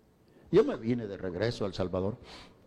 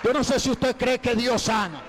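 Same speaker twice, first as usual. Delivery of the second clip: full-force preaching; 13 semitones higher than usual. Acted. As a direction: proclaim the healing to the whole church. Preacher at the climax of the testimony. (predicación a todo pulmón, aguda y fuerte)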